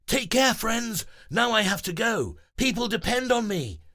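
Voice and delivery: high, loud hero voice; heroic farewell. Acted. Breezy saying goodbye before flying off. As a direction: bid farewell nobly.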